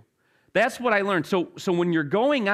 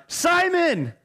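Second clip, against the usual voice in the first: high shouted name; calling out; 12 semitones higher than usual. Acted. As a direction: call across the boat. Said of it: "And he's like".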